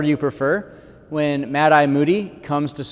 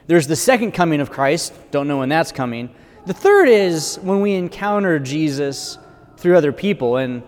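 ordinary delivery

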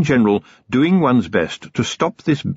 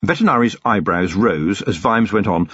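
ordinary delivery, nothing marked

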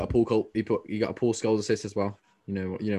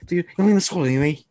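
high, loud mock Scouse; imitating an excited Scouser; Scouse accent; 6 semitones higher, 5 dB louder than usual. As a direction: put on an exaggerated Scouse accent. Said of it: "it was a Scouse accent".

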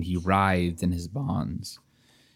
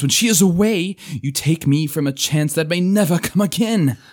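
loud, anguished archaic plea; desperate pleading knight; 5 semitones higher, 5 dB louder than usual. Acted. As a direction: beg with rising desperation.

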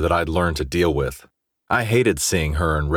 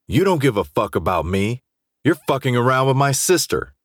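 angry accusation; 7 semitones higher than usual. A narrator's reading. Drunk Jace confronts his best friend, angry and accusing. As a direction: pitch climbing into heated accusation.

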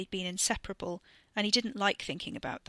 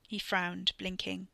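plain reading voice

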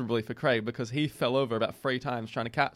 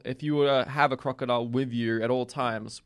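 ordinary talk

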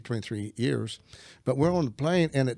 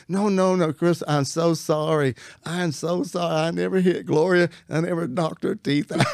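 rushed pleading apology, higher and louder; frantic apology; 5 semitones higher, 4 dB louder than usual. Retold or imitated. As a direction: beg her forgiveness in a rush. Frantic apology to scared wife.

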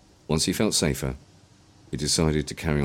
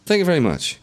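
high, louder, overly polite nervous thanks; hiding nerves; 13 semitones higher, 5 dB louder than usual. A narrator's reading. William thanking the menacing man, nerves barely hidden. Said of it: "trying not to give his nerves away".

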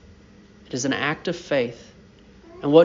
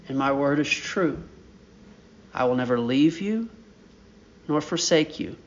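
low, soft and tender, a promise whispered close; God's gentle promise; 3 semitones lower, 4 dB quieter than usual. Acted. Preacher voicing God's promise to his people, soft and tender. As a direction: speak as God, quietly reassuring. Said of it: "And he says".

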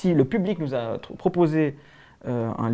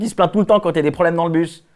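normal talking voice

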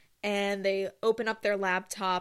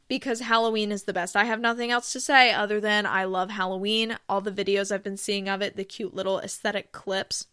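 ordinary delivery

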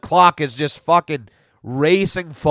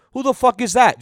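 put-on higher, cheeky kid voice; imitating the kid; 7 semitones higher than usual. The kid asking who truancy officers are, cheeky imitation. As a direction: toss it off like a bratty six-year-old. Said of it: "He goes".

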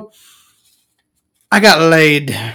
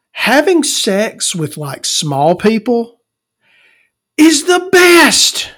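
high, slow and drawn out, louder at the end; slow emphatic; 9 semitones higher, 5 dB louder, slower than usual. Real, not acted. Telling the audience his big lesson, slow and rising. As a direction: stretch it out, rising to a high loud peak. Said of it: "one thing that I learned".

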